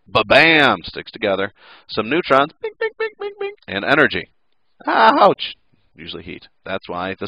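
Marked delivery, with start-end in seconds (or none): 0.1-0.8 loud, punched ba-bam; playful crash sound effect; mock impact. Imitates particles slamming together. 2.6-3.5 very high, tiny bings; ping like little particles flying off; high-pitched imitated bing-bing; playful pinging noises. 4.9-5.5 high, loud cartoon yelp; hammed-up "ouch"; mock pain. Acts being burnt by energy.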